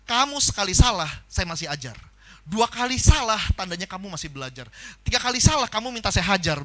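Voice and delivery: loud, forceful imitated boss's warning; mock-threatening warning to a new hire; stern boss warning. Boss recounting stern warning to newcomer.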